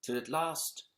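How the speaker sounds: put-on gentle storyteller reading to children; bedtime story reading. Reads bedtime story aloud.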